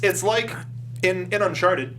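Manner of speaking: ordinary talk, nothing marked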